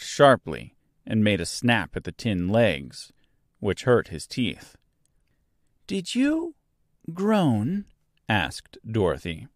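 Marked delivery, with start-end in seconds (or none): 5.9-7.8 put-on high-pitched, hesitant girl's question; wary girl. A narrator's reading. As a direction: ask carefully in a high girl's voice. Dorothy questions the groaning stranger.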